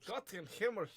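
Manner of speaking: mock-German cry to heaven; German expression. Shown as a demonstration. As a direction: echo it with German gusto. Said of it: "I love that".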